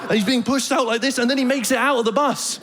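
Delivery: ordinary delivery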